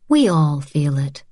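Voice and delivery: ordinary delivery, nothing marked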